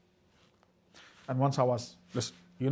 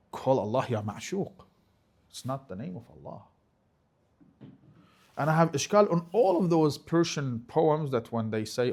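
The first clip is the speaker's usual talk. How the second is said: ordinary delivery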